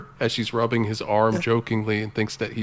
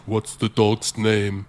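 slow robotic Terminator; 4 dB louder, slower than usual. Retold or imitated. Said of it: "and he says".

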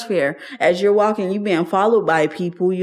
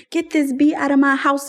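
lady of the house throws her out, mock angry quote, higher; raised-pitch retelling of an angry order to leave; imitating the landlady's outburst; 8 semitones higher than usual. Retold eviction shout from the lady of the house, mildly acted. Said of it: "Like, she went full on".

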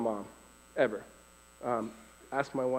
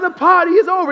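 high, loud preacher voice at normal speed; preacher's punchline; 15 dB louder than usual. Retold or imitated. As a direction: hit it straight like the repeated refrain. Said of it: "And he was like".

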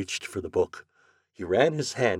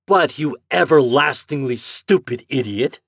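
louder furious insult; angry shout; 8 semitones higher, 9 dB louder than usual. Acted. Morehouse shouting at the agent, furious. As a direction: spit out the insult. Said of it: "shouted Mister Morehouse".

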